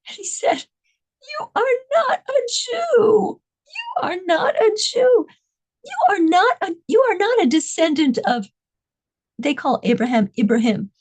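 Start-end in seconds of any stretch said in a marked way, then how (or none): 1.4-8.5 scornful jailer. Retold or imitated.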